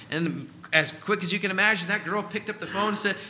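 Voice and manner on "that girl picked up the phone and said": ordinary talk, nothing marked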